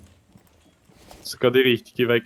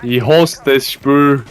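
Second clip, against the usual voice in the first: raging gamer; 7 dB louder, much slower than usual. Real, not acted. Gamer frustration.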